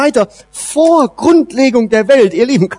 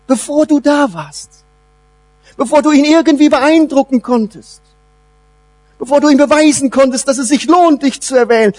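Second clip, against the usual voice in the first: ordinary delivery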